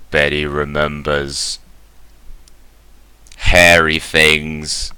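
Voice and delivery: slow, level robot delivery; drawn-out syllables on one note; monotone robot voice. Acted. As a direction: intone it like a machine reporting. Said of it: "monotone robot voice".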